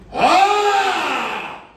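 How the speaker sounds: high, loud demonic cry from the grave; voice from beyond the grave. Retold or imitated. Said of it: "con una voz como de verdad, de un viejo de ultratumba".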